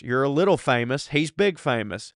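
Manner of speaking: ordinary delivery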